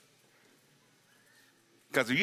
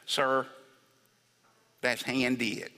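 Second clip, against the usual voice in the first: softly imitated Texas drawl; Texas accent; 5 semitones lower, 4 dB quieter, much slower than usual. Saleswoman correcting a customer about the necklace, Texas accent. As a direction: quote her in a gentle Texas accent. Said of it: "That's an accent".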